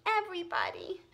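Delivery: put-on high upset girl; high tearful answer; tearfully. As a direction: whimper it to the teacher. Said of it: "said Susan tearfully".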